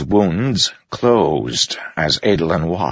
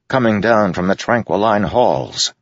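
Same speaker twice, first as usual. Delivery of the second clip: plain reading voice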